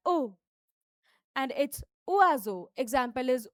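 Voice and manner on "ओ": "ओ" with a light h sound in it; breathy French O. Shown as a demonstration. Teacher showing the French O, with a hint of h. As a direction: breathe a little h into "ओ". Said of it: "ओ में हमें हल्का सा एच का sound डालना".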